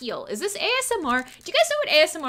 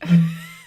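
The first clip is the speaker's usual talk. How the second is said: very high squealing laugh; 9 dB louder than usual. Real, not acted. Streamer squealing with laughter before a shot.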